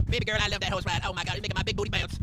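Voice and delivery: Harley gushing about the horse ride; mock girl voice. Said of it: "impression ... horse riding".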